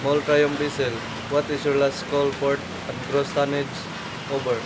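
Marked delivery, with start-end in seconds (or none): none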